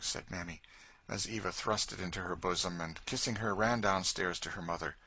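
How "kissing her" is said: ordinary delivery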